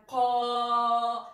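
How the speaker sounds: "qa" emphatique et grave; heavy qa. Shown as a demonstration. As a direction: say qa heavy and long. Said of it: "C'est plus grave".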